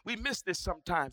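ordinary talk, nothing marked

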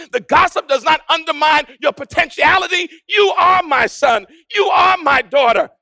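ringing proclamation with drawn-out key words; insistent, building sermon refrain; full-force preaching. Acted. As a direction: proclaim it as God's own affirmation.

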